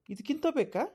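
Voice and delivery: a high, indignant demand; defiant challenge. Acted. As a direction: fling the question up high at them. Husband defends himself at panchayat.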